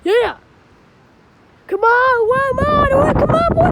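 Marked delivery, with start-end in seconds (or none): 1.7-3.7 loud excited yell; hyped loud cheering shout. Real, not acted. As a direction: yell it out at the storm. Cheering and yelling at the storm.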